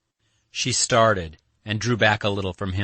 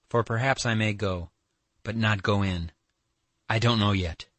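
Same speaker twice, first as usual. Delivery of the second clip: ordinary delivery, nothing marked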